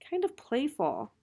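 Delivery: ordinary delivery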